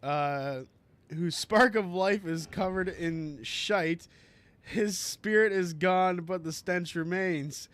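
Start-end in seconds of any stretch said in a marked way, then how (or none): 1.1-7.7 slow, raised and louder, like a medieval herald; grand herald declamation. Retold or imitated. Streamer reciting the movie herald's insult, grandly. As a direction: proclaim it to a jousting crowd, slowly. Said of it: "so fucking good that you remember that much".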